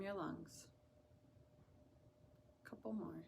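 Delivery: soft, unhurried meditation voice; hushed, soothing guidance with long pauses; guided meditation. Acted. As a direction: ease them gently into relaxation, softly.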